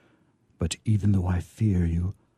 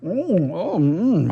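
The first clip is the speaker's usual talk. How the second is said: higher, slowed, humming between words; tipsy; 8 semitones higher than usual. Acted. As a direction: let the drink loosen you, savouring each hum. Angel tipsy from the drink.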